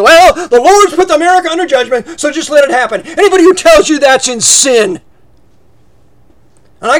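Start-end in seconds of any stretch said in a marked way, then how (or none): 0.0-5.0 mock heretic preacher. Mocking heretic teachers.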